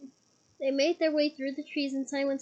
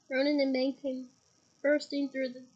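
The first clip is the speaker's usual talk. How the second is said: plain reading voice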